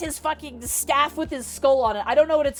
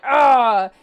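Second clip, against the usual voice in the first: loud imitated orc roar; war boss roar; 8 dB louder than usual. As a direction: give a loud "ugh" like an angry orc boss. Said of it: "the war boss immediately is like".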